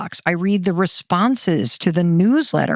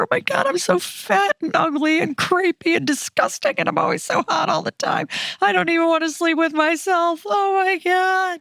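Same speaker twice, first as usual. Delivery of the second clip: higher, whiny self-critical rant; frantic inner voice; 8 semitones higher than usual. Pretend. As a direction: squeal out your own worst thoughts as a joke. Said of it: "This is what my thoughts used to sound like".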